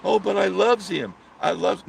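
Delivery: high, loud mocking imitation; mock lovestruck girl. Host mocking girls who say they love him, lovestruck.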